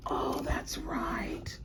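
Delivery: slow, breathless whisper of recognition; awed whisper. Real, not acted. Vlogger recognizing her present, slow awed whisper. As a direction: whisper it slowly in amazement.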